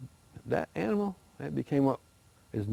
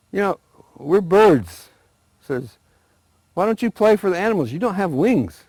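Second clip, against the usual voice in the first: higher, louder explaining; eagle voice; 9 semitones higher, 7 dB louder than usual. Acted. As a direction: talk down to little mice. Eagle answers mice.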